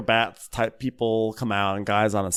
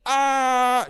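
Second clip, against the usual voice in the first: high-pitched mock scream, held long; imitated scream; 13 semitones higher than usual. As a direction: let out a mock shriek. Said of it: "She screams".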